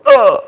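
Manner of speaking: high loud groan as it keels over; fainting goat groan. Retold or imitated. Imitating the fainting goat falling over, groan. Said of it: "this other goat going".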